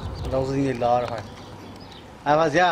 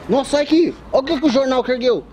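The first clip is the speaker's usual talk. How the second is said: excited; 9 semitones higher, 3 dB louder than usual. Real, not acted. Excited at the kite.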